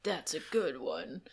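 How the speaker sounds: slow, put-on deep cop voice; drawn-out mock deep delivery. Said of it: "in a very deep voice".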